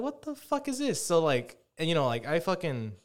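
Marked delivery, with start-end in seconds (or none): 0.0-1.0 high, slow, drawn-out what the fuck; stunned disbelief. Retold or imitated. After the receptionist hangs up, high incredulous outburst. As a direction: let the pitch shoot up in disbelief. Retelling being hung up on.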